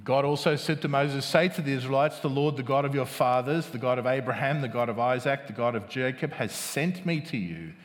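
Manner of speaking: low, hushed scripture reading. Acted. Reads scripture aloud to congregation.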